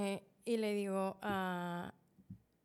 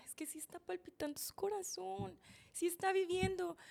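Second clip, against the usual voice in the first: high-pitched pleading denial; retold desperate insistence; 10 semitones higher than usual. Wife insists to the doctor he lives, desperate high plea. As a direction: plead that there is still life. Insists the heart still beats.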